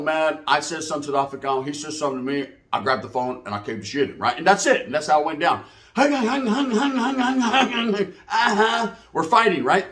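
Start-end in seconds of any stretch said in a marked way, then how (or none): 5.9-8.1 fight noises. Retold or imitated. Imitating punches in the fight.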